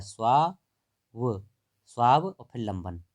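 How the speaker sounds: स्वाव, then लंबन, said as separate chunks; spelling it out. Shown as a demonstration. Teacher showing how it is written, chunked demo. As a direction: split the word into स्वाव and लंबन, deliberately. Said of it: "देखो ऐसे लिखा जाता है".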